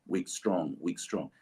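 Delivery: weak, strong tapped out softly; quiet da-DUM rhythm; iambic beat. Shown as a demonstration. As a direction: pulse it like a heartbeat. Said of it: "he writes with that pulse".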